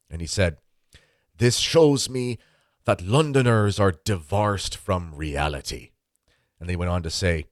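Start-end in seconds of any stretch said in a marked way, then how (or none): 1.4-5.9 put-on very slow, higher Irish priest accent; slow, emphatic old Irish priest accent; Irish priest impression. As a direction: pronounce it in an Irish accent like a pulpit verdict. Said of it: "I'll do my best Irish impression".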